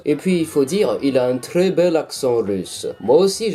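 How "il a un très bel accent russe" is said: low, slow put-on Russian accent; mock Russian accent. YouTuber repeating his praise of the villain, mock Russian accent. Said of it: "je sais faire l'accent russe".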